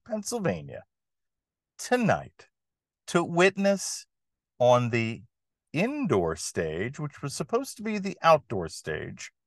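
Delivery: showman announcing the act; booming circus ringmaster intro. Acted. Ringmaster style podcast opening.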